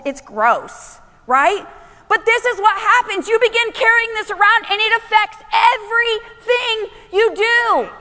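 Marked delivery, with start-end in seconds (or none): 2.1-7.9 high, raised preaching voice; urgent, high-pitched sermon delivery; full-force preaching. Acted. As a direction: drive each phrase home to the congregation.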